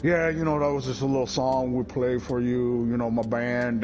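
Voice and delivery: lightly voiced low, sultry [name]; a hint of [name] talking to the crowd. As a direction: croon it low and smooth. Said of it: "low but sultry".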